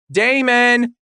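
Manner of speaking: high, loud call up the stairs; yelling out. Acted. Daughter yelling for her brother, loud high call. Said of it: "Devaney yells out".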